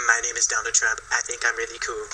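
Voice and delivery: low, soft early voice of a politician; imagine the president before the swagger, low and quiet; Donald Trump. Retold or imitated. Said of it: "probably first sounded like".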